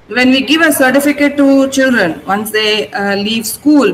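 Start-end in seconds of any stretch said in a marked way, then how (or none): none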